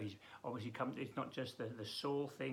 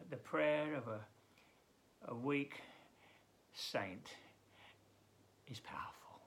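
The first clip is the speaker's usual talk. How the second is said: ordinary talk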